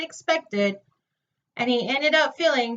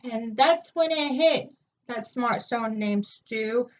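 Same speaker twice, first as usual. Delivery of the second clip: ordinary delivery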